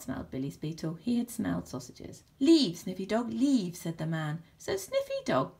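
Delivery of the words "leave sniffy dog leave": loud, firm dog command. A narrator's reading. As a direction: order the dog off loudly. Man orders dog off sausages.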